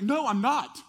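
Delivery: loud, high outburst of denial; Peter's heated denial, acted at full volume; shouted denial. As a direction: shout it back at your accuser. Acts Peter's heated denial to accuser.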